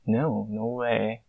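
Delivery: ordinary talk, nothing marked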